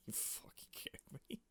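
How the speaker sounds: unvoiced incredulous whisper; a breathless whisper of disbelief. Real, not acted. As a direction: hiss it in disbelief.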